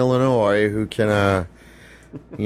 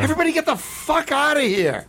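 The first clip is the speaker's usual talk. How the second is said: angry yelling. Pretend. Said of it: "I would just go".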